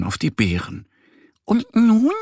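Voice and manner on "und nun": much higher, louder duck voice; sighing duckling. A narrator's reading. Duck Annie wondering what to do, high squeaky sigh. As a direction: ask it with a squeaky little sigh. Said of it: "seufzt Annie".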